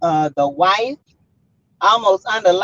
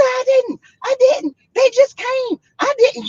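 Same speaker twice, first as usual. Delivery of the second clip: high, whiny pleading; pleading denial; 12 semitones higher than usual. Retold or imitated. Husband cornered by his wife, high pleading denial. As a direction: beg her to believe you.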